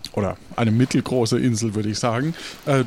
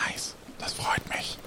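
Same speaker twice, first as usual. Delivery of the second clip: whisper; 11 dB quieter than usual. Acted.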